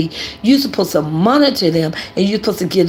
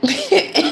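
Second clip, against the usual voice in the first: laugh; 11 semitones higher than usual. Real, not acted. Laughs at own story.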